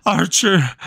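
high, panting, word by word; exhausted boy panting his name to the witch; breathless answer. A narrator's reading. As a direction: gasp out the name. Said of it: "between heavy breaths".

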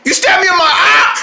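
high, loud outraged yell; indignant yell. Acted. Hot dog yelling at the rabbit in outrage. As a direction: blast the accusation. Acted accusation after stabbing.

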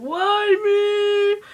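high, loud mock lament; mock crying wail. Imagining a friend crying in the corner, mock wail. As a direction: wail it in hammed-up despair. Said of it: "Nikki probably crying in the corner".

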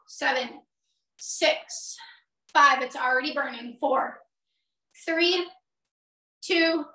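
usual speaking voice

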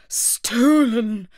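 king's booming accusation; loud, accusing outburst; loud exclamation. A narrator's reading. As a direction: exclaim it loudly, drawn out. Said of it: "the king exclaimed".

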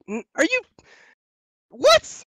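very high, loud incredulous "what?"; stammer, then shoot up in disbelief; acted-out disbelief. Acting out his disbelief at her.

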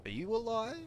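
high falsetto question; very high, playful query; mock questioning. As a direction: squeak it upward in a silly voice.

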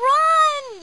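long, high, loud yell; Huckle yelling. A narrator's reading. Huckle urging Lowly to flee the ghost, yelling. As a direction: yell it out urgently, stretched and high. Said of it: "yells Huckle".

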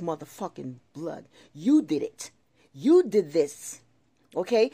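loud, emphatic real anger; angry warning. Real, not acted. Resident warning the housing authority to tread light, angry. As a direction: confront them, loud and forceful.

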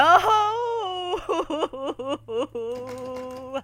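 loud laughing shriek; half laugh, half cry of fright; panicked laugh-cry. Real, not acted. Scream-laugh after scare.

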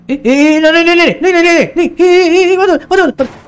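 high, loud string of startled "え"; panicked surprise. Real, not acted. Gamer suddenly falling through the ground, panicked yelps. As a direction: caught off guard, spluttering in alarm.

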